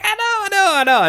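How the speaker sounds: very high mock whine; sheepish squeak. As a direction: squeak it like a caught kid. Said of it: "And I was like".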